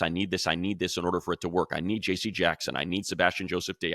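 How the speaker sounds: usual speaking voice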